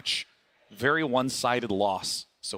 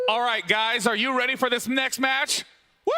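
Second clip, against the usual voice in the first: hyped-up announcer; 10 semitones higher than usual. Real, not acted. Host firing up the arena crowd, excited. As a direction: pump the crowd up for the match. Hyping crowd before next match.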